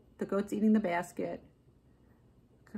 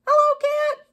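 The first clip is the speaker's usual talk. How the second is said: cheery duck voice; 9 dB louder than usual. A narrator's reading. Duck calling out to Cow as he rides past, cheery duck voice. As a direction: call out high and loud as a little duck. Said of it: "said Duck".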